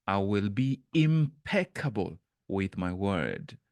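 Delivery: slow, with "impeccable" drawn out and stressed. Shown as a demonstration. Reading Anne's pledge, slow, stressing impeccable. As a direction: weigh each syllable of "impeccable". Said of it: "which word am I emphasizing".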